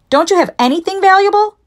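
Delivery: very high, loud plea; loud, incredulous robber's question; exasperated robber. A narrator's reading. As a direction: pitch it way up, exasperated. Exasperated robber presses for valuables.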